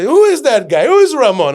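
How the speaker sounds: high, loud demanding questions. Retold or imitated. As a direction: call out sharply who is Ramon. Inspector quoted, loud questions.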